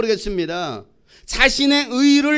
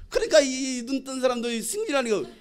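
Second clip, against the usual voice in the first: ordinary talk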